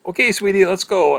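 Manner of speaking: high, loud coaxing sing-song; doting sweetie voice. Retold or imitated. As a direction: chivvy a grandma along sweetly. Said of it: "I'm like".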